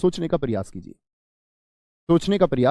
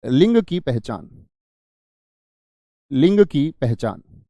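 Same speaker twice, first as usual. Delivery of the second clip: each word spaced out slowly for notes; slow and clear so students can write it down; slow dictation; 2 semitones lower, much slower than usual. Shown as a demonstration. Teacher slowly dictating a note heading to students. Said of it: "note बना के लिखना".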